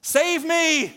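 high, loud cry for rescue; acted desperate yell; yelled plea. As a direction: shout it at the top of your lungs. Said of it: "what you just yelled at the top of your lungs".

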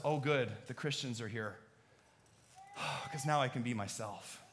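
soft, relieved and grateful; relieved partygoer who can finally be himself, sincere and soft; sincere relief. Pretend. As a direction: exhale in genuine relief.

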